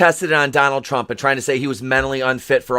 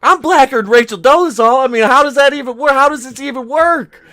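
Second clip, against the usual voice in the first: high, loud disbelief; worked-up, high-pitched questioning; incredulous outburst; 9 semitones higher, 4 dB louder than usual. Retold or imitated. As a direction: retell your bafflement at a high pitch. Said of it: "I'm like".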